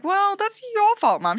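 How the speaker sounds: high, slow teasing blame; mock accusing. Retelling chairlift chat, teasing mum about fault. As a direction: pin the blame on mum, half-joking.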